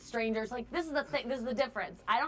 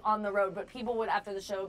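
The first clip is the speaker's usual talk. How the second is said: normal talking voice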